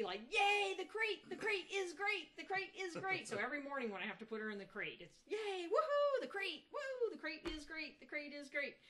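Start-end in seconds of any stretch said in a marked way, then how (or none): 0.3-3.3 very high, loud cheery chant; high, loud singsong cheer for the dog; fake happy. Shown as a demonstration. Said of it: "you act all happy". 5.3-8.8 very high, loud cheery chant; high, loud singsong cheer for the dog; fake happy. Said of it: "you act all happy".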